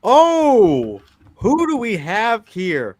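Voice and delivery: loud, slow, high game-show welcome; showy host voice. Pretend. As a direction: announce him like a TV host. Mock host welcoming Samson.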